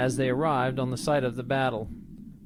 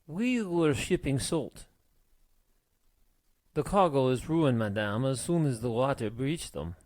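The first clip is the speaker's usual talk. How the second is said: put-on French accent; 4 dB quieter than usual. A narrator's reading. Frenchman tells captain of the ruined salt, French accent. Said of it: "the Frenchman explained".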